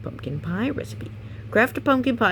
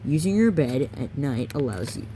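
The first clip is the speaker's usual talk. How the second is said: plain reading voice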